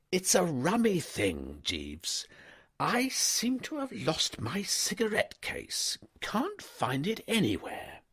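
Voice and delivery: put-on an easygoing young master stopping his valet; young toff. A narrator's reading. Wooster, tired, lost cigarette case.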